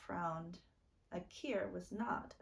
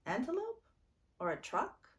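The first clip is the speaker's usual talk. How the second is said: put-on curious child; 6 semitones higher, 4 dB louder, faster than usual. A narrator's reading. Little sister asking eagerly.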